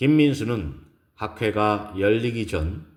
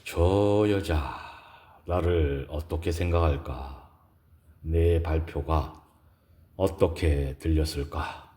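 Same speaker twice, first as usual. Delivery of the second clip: 속으로 묻는 혼잣말; 5 semitones lower, 3 dB quieter than usual. A narrator's reading. Narrated hero's inner question, voiced. (낮고 느리게 속으로 되묻는 독백)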